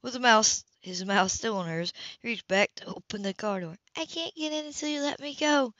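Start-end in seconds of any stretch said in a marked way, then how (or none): 4.0-5.7 higher woman's voice; heroine's higher teasing voice; female character voice. Acted. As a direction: lift into her voice, teasing. Heroine speaks.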